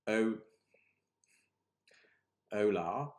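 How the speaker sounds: loud, halting pupil reading out Hola; reading badly. Shown as a demonstration. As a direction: stumble over the words like a struggling pupil. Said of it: "if I'm gonna read it badly".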